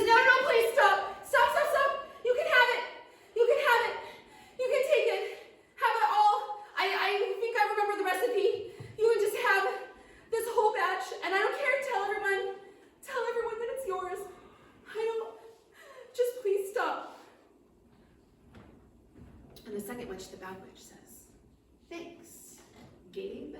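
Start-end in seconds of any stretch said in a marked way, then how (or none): 0.0-17.2 high, loud cowering plea; panicked begging. Acted. Cowering gnome begs witch to stop. 21.9-23.5 cool thanks. Acted. Bad witch coolly takes the potion.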